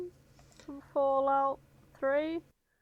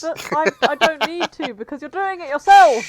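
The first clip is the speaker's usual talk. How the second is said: loud teasing; 6 semitones higher, 12 dB louder than usual. Real, not acted. Catching friend out, teasing.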